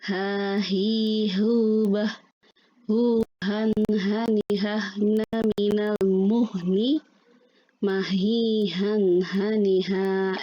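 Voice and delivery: breathy "ha" from mid-throat, each syllable apart; reciting ha syllables. Shown as a demonstration. Teacher drilling the letter ha for students, breathy. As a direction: push air out on every "ha". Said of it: "huruf kedua adalah ha".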